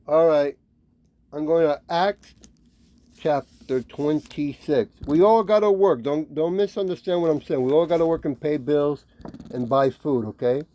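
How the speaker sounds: ordinary talk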